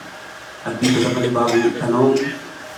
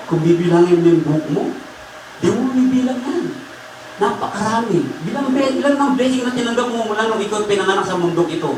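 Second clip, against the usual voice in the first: raised, higher-pitched sermon voice; impassioned preaching; 9 semitones higher than usual. Acted. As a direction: exhort the congregation to exalt God. Preaches and proclaims praise. (madamdaming pangangaral at pagpupuri)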